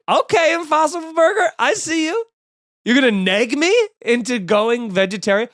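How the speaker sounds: ranting at a pushy burger billboard; indignant outburst. Real, not acted. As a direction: yell back at the ad.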